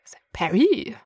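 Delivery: whispered French-accented peri; mock French accent. Shown as a demonstration. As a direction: breathe "peri" the French way, half-joking. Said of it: "we went French for a second".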